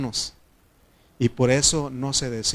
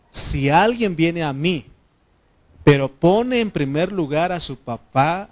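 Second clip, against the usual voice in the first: preaching scripture; 2 semitones higher, 6 dB louder than usual. Acted. Pastor booms a Bible verse to the church, loud preaching. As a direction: proclaim the verse to the congregation, loud and lifted. Preacher reads another version.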